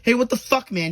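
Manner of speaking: high, loud outraged shout; angry yell. Retold or imitated. Kart driver yelling at the rammer, outraged. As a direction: yell at him for ramming your kart. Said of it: "He was like".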